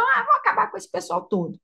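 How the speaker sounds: high, exasperated divine voice; lightly voiced exasperated God; God fed up. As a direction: voice God losing patience with the people. God voiced deciding to destroy.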